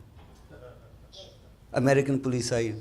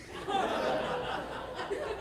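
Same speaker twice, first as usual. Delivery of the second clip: high breathy laugh; 7 dB quieter than usual. Real, not acted. Comedian laughs at own joke.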